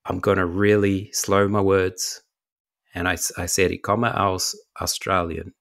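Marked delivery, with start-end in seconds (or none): 0.1-2.2 slow, deliberate and clearly spaced; careful, drawn-out delivery for a listener; slowed-down words. Retold or imitated. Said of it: "I said it clearly and slowly". 3.7-5.5 slow, careful German; deliberate answer, spaced out to be understood; clear and slow. Retold or imitated. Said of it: "I said it clearly and slowly".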